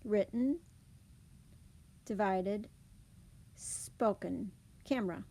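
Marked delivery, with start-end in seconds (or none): 4.8-5.2 "camera" in two syllables, CAM-ruh; syncopated schwa. Shown as a demonstration. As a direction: drop the middle vowel of "camera". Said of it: "It's a deletion of syllables".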